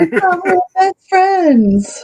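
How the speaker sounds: high, slow wounded whine; whiny hurt voice. Acted. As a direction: play it hurt and betrayed. Voicing wrestler's hurt line.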